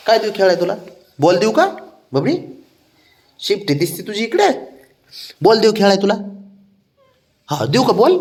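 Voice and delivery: high playful offering voice; coaxing offer. Real, not acted. Father tempting sulking pet with a ball, high coaxing. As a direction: tempt them with a toy, voice lifted high.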